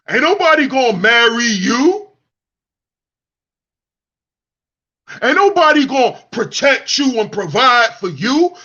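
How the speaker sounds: mocking men cursing the woman who left; mocking bitter man. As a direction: whine it like a bitter ex. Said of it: "You dudes sound like women".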